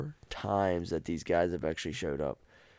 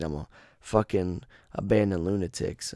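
ordinary delivery

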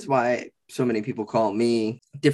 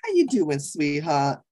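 much higher, sweet motherly voice; motherly coworker; 4 semitones higher than usual. Retold or imitated. Voicing the motherly coworker Matilda, sweet high reply. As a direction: coo it like a loving older lady.

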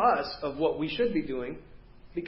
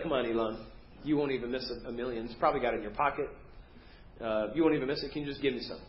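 softer, lower mock wheedling; casual coaxing; 4 semitones lower, 6 dB quieter, faster than usual. Preacher pretending to ask Elon for money, coaxing quietly. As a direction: sidle up and coax, half-joking.

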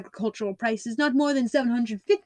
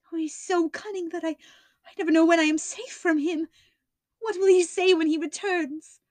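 higher, pleading fearful voice; anxious fear; 8 semitones higher than usual. Acted. Miss Stoner anxiously tells Holmes how she fears the doctor. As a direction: let the dread rise on each phrase.

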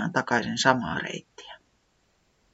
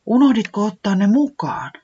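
worried; 7 semitones higher, 3 dB louder than usual. Acted. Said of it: "Reporankka kysyi huolestuneena".

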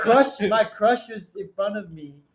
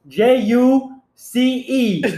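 slow, louder, higher letters; spelling out loud; 5 semitones higher, 4 dB louder, much slower than usual. Retold or imitated. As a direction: announce each letter confidently. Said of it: "So I spell it out loud".

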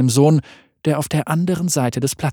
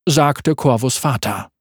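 ordinary delivery, nothing marked

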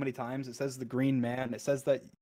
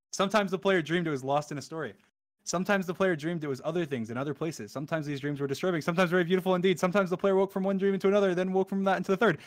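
reading aloud faster and a bit higher as the text runs away; poem reading; 5 semitones higher, 4 dB louder, faster than usual. Acted. Gamer racing the scrolling End Poem, still reading it out. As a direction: try to keep up with scrolling text.

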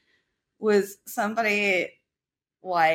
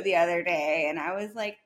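usual speaking voice

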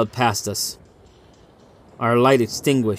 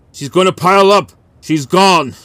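louder, higher despairing cry; crying out in dismay; 5 semitones higher, 6 dB louder than usual. A narrator's reading. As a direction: shout as the ship is lost. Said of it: "cried Legal".

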